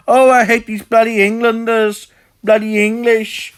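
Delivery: high, loud mock-Welsh accent; hammed-up Welsh grumbling, pitched well up; Taffy. Shown as a demonstration. Mocking a YouTuber who hates the English, high Welsh impression. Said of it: "Just doing a, uh, Taffy impression".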